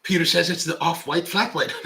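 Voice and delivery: ordinary talk, nothing marked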